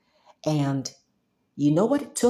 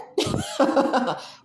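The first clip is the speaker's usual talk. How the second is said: laugh. Real, not acted. Laughs at absurd logic.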